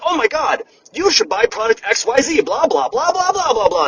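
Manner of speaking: high, bright mock sales pitch, gushing; mock pushy influencer. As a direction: parody an overeager influencer selling you stuff. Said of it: "they're like".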